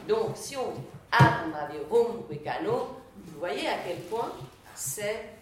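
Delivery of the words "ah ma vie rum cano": "Ah, ma vie, rum, cano" beaten out in loud, separate feet; artificial scansion. Shown as a demonstration. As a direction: hammer the beats of "Ah, ma vie, rum, cano", slowing down. (« Ah, ma vie, rum, cano » scandé fort, artificiellement)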